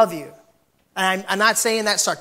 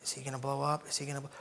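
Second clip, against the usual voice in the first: hushed coworkers' worry; 4 semitones lower, 12 dB quieter, faster than usual. Retold or imitated. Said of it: "They're like".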